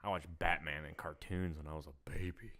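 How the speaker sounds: whispered aside. Real, not acted. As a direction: say it under your breath. Quiet aside while chatting.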